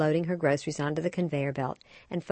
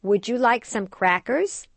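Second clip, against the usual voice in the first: put-on higher, slow sing-song question to a baby; coaxing mother; 6 semitones higher, 5 dB louder, slower than usual. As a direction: ask a toddler gently and slowly. Mother asking toddler.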